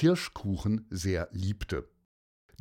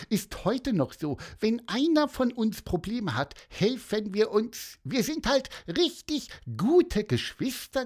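high, squeaky little bird voice, proud and cheerful; bird Pieper's voice; 11 semitones higher than usual. Acted. Pieper tells Lukas about his siblings, squeaky high bird voice. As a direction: chirp it like a tiny happy bird.

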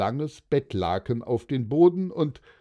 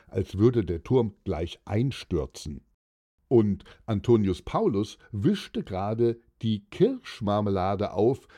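ordinary delivery, nothing marked

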